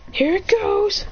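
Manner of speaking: high-pitched launching call; battle call. Acted. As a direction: lift it high as you charge. Hero launches the attack.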